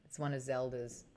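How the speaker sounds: low, softly voiced aside; quiet aside. Real, not acted. Streamer on the missing hair, quiet aside. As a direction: say it softly, to yourself.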